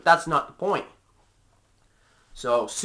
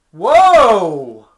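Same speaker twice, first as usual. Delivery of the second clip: startled whoa; 3 semitones higher, 11 dB louder than usual. Real, not acted. Reacting to being called liar.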